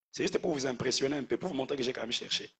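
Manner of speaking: throwaway confession, soft and hurried; quick aside. Real, not acted. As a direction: drop the voice and rattle it off. Joking aside on his own research.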